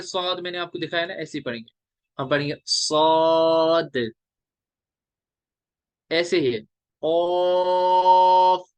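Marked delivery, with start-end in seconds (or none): 2.7-4.1 "सौद" held very long on its vowel; three-alif elongation. Shown as a demonstration. Teacher demonstrating to students how long to pull it, drawn out. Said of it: "तीन अलिफ". 7.0-8.7 "ऑफ" held long, loud and level; three-alif elongation. Shown as a demonstration. Teacher models the letter for students, long drawn-out. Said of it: "ये भी तीन अलिफ".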